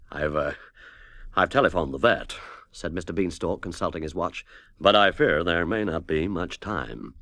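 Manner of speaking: a hint of a worried businessman; lightly voiced grave producer. A narrator's reading. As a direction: speak gravely, weighing each word. Mort Beanstalk, grave producer.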